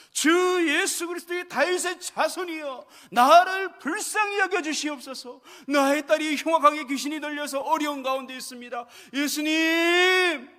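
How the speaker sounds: loud, pleading cry to Jesus. Acted. A desperate mother crying out to Jesus for mercy. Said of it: "이렇게 소리를 지르는 거예요".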